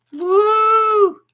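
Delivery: high, loud cheer for the winner; excited whoop. Real, not acted. Host cheers as Joanne wins the piece, high whoop. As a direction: let out a joyful woo.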